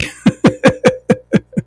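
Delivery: very high airy laugh. Real, not acted. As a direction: burst into a high giggle. Laughing at the fancy dessert.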